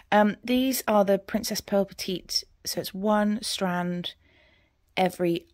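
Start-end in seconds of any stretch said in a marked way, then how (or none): none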